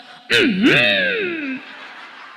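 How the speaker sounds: loud, strained mock held-in cough; suppressed cough. Father holding in his cough, exaggerated strained grunt. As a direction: fake a cough squeezed into a long high hum. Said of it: "bapake watuk diempet".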